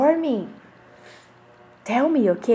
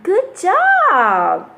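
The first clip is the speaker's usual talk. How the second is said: higher and louder praise; bright teacher praise, higher and louder; excited praise; 6 semitones higher, 5 dB louder than usual. Real, not acted. Praising children.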